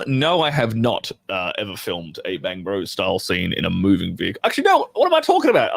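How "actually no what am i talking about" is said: much higher, self-correcting surprise; startled at own mistake; sudden realisation. Real, not acted. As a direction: catch yourself mid-sentence, amused.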